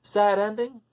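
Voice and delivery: a hint of a small mouse asking; light mouse voice; lightly voiced mouse. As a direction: ask it as a little mouse. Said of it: "asked the mouse".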